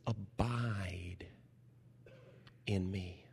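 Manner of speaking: hushed scripture quote; whispered Abide. Retold or imitated. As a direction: breathe the words like a secret. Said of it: "Jesus says".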